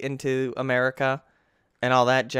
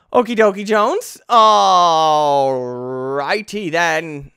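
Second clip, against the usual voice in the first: loud, raised, exaggerated delivery; hammed-up catchphrase; 3 semitones higher, 11 dB louder, slower than usual. Player after failing the jump, hammed-up and loud. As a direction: belt it out, dragging Alrighty long.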